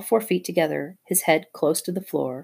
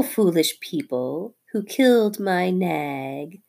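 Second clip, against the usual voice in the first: slow, higher drawn-out snake voice; menacing cobra; 4 semitones higher, 3 dB louder, slower than usual. Acted. Nagina threatening Teddy's family, slow menacing cobra. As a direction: hold them frozen with slow menace.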